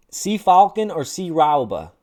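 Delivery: loud, slow mock-German names; German impression. Shown as a demonstration. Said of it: "this is my best German impression".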